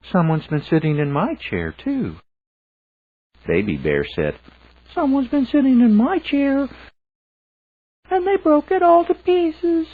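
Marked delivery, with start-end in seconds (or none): 0.1-2.2 much higher, quicker mother bear; Mama Bear voice. A narrator's reading. Mama Bear finding her chair used, high and quick. 4.9-9.9 very high, loud little cub voice; Baby Bear voice. A narrator's reading. Baby Bear wailing that his cobbler is gone, very high and loud.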